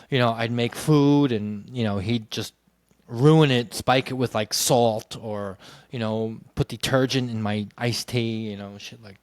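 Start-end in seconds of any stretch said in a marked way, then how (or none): none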